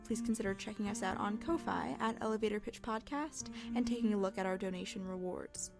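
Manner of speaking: ordinary talk, nothing marked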